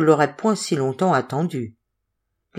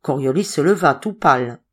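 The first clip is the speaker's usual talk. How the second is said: plain reading voice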